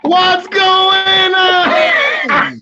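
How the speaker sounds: long, high, loud stretched greeting; excited drawn-out shout. Real, not acted. Guest jumping onto the live stream, excited shout. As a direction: burst in hollering hello to your buddy.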